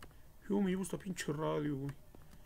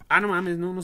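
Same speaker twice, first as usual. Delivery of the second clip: loud startled outburst; sorpresa fuerte; 4 semitones higher, 9 dB louder than usual. Real, not acted. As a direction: blurt it out in disbelief. Game says option unavailable. (exclamación sorprendida y fuerte)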